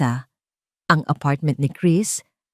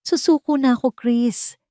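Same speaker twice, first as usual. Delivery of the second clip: higher, breaking voice of giving up; tearful surrender; 8 semitones higher than usual. A narrator's reading. Eliza tells Chris she gives up, tearful. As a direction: plead through tears. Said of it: "Ang mga luha ay malayang dumadaloy".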